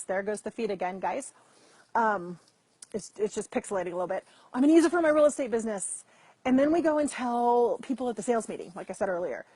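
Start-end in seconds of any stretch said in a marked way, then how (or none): 4.5-6.0 higher, louder, quick eager burst; acted-out excited outburst. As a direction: announce your new plan with rushing enthusiasm. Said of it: "we get excited about".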